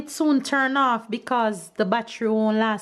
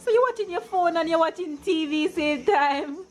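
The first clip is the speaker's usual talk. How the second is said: amused surprise; 9 semitones higher than usual. Real, not acted. Amused at husband multitasking.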